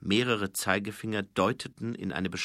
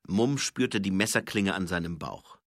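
usual speaking voice

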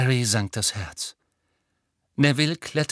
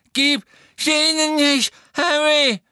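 anguished high-pitched bellow; frantic roar; 6 dB louder than usual. A narrator's reading. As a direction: scream it desperately, beside yourself. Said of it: "brüllte Neville".

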